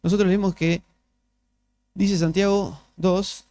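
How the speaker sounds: ordinary talk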